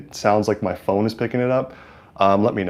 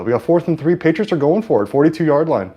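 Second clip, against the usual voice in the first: rising, louder sportscaster call of the down; play-by-play call; 7 semitones higher, 4 dB louder than usual. Acted. Streamer calling the Patriots' fourth down for chat, louder announcer voice. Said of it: "announce through it right here".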